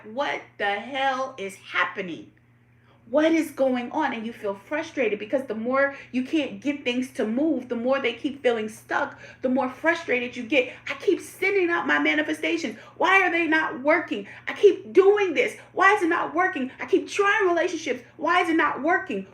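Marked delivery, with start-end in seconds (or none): 0.0-4.1 a bewildered cry that flares up much louder; frustrated outburst. Retold or imitated. To the viewers, voicing the mind in a whirlwind, loud exasperated cry. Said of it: "it makes you feel like". 10.9-19.3 higher and much louder with every line, exasperated; venting, each complaint climbing over the last; mounting frustration. Acted. To the viewers, acting out stuck manifestations, rising exasperated rant.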